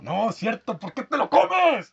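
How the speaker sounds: high, loud, drawn-out protest; exaggerated disbelief, voice shooting way up; mock outrage. Real, not acted. Host protesting at friend who eats liver and onions, outraged.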